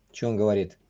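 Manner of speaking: normal talking voice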